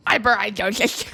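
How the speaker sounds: goofy voice copying her friend. Acted. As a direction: echo it in a goofy cartoon voice. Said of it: "I make goofy vo".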